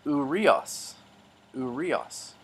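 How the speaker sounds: "urios." slowly, oo-ree-os; slow model pronunciation. Shown as a demonstration. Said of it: "I'll pronounce these words in the middle".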